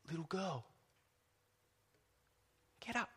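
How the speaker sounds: soft, gentle wake-up call; tender. Shown as a demonstration. Preacher showing how tenderly Jesus woke the girl, soft and gentle. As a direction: coax her awake tenderly. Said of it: "You see the tenderness?".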